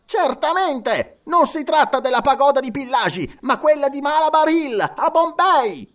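very high, loud eager correction; excited insistence. A narrator's reading. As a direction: insist heatedly. Passepartout explains heatedly. (Passepartout concitato, acuto e forte)

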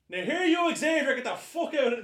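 put-on high, loud North Tipperary Irish accent; Magneto gone Irish. Helmeted villain who can't hear himself, broad Irish accent. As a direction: shout it in a hammed-up Irish accent. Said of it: "straight away he has an Irish accent".

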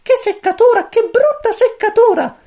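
very high, loud squeaky grumble; grumbling chick. A narrator's reading. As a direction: complain like a tiny annoyed chick. (pulcino che borbotta, voce acutissima)

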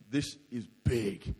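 low, slow, soft uh-oh; scared realisation. Retold or imitated. Surfer out in six-metre waves, low and slow in fear. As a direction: let the fear sink in quietly.

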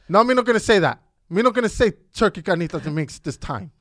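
put-on loud, high toddler protest; pissed-off little boy. Little boy refusing to tell his sister, loudly defiant. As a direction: imitate a stubborn three-year-old refusing. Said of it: "this fool gets all pissed off".

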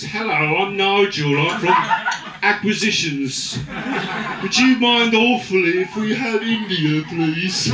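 hammed-up posh Englishman; polite English colonist. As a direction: ask for a country like borrowing sugar. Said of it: "Just show up in fucking countries".

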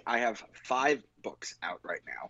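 a whispered confidential aside. Real, not acted. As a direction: lean in and whisper it.

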